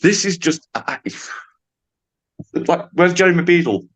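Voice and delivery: high-pitched, stammering mock dismay; acted disbelief. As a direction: sputter it high, as if pranked. Acted disbelief, suspecting a prank.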